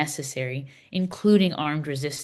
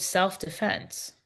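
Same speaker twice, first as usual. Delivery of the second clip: scare quotes; 6 dB quieter, much slower than usual. Real, not acted.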